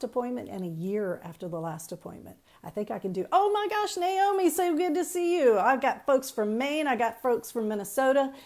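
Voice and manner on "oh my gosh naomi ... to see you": high, bright burst of delight; light up at a familiar name; excited greeting. Real, not acted. Greeting a viewer joining live.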